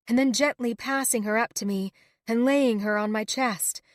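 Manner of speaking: usual speaking voice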